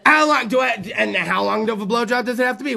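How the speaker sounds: ordinary delivery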